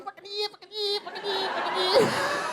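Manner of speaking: high mock-dolphin Australian chant. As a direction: squeal it like a dolphin. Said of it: "following him were Australians, like dolphins".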